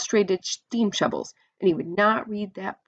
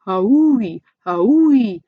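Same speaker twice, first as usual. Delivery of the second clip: wailing locomotive; 3 semitones higher, 9 dB louder than usual. A narrator's reading. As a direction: wail it out like a steam engine. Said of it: "wailed, wailed Ramona".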